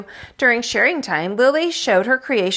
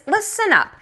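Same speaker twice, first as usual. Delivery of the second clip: put-on much higher, commanding child; bossy pretend teacher; 10 semitones higher than usual. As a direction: call the class to order. Lily playing teacher.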